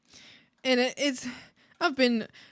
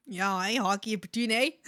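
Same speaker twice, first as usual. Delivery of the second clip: badly faked Newfie accent; mock Newfie accent. Shown as a demonstration. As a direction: attempt a thick Newfoundland accent. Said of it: "See, I can't even do it".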